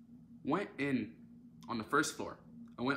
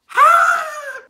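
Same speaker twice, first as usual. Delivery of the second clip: loud exasperated groan; 15 dB louder than usual. Real, not acted. Peak of the suspenseful story.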